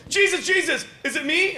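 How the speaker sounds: eager Judas. Retold or imitated. Comedian voicing Judas at the Last Supper, high and giggling.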